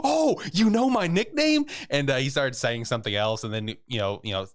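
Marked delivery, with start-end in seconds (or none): 0.0-1.7 high, loud, drawn-out mock delight; super excited. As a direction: imitate someone thrilled, voice leaping way up. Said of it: "he got super excited".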